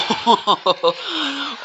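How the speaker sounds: high bursting laugh; delighted laugh. Real, not acted. Delighted by a great play.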